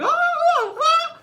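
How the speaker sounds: high hammed-up shriek; mock frightened squeals; squealing friends; mock friends' scream. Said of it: "my friends would be like".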